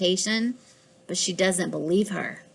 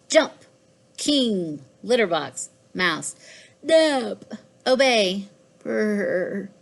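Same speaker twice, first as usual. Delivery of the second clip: plain reading voice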